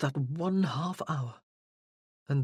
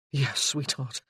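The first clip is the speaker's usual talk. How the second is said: meekly; 3 semitones lower than usual. Acted. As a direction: give in sheepishly. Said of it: "he said meekly".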